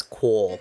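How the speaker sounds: "Cole" as no Texan would say it; imitated fake accent. Co-host mocking fake Texan speech, imitating "Cole". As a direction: stress "Cole", the mispronounced call. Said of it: "Nobody in Texas says".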